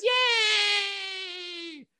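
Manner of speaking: long high squealed cheer; mock cheer. Crowd greets Flemmy the puppet, cheering. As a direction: squeal it and hold the note.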